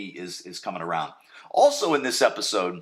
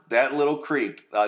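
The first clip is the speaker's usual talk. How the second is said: usual speaking voice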